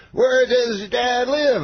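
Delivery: high, slow mimicked question; Bill Cosby. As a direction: stretch the question in a high impression. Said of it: "He goes".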